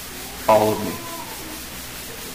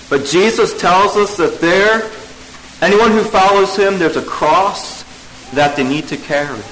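normal talking voice

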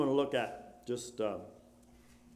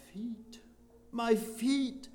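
anguished lament; 11 semitones higher, 14 dB louder, much slower than usual. Retold or imitated. Voicing the man crying over lost feet.

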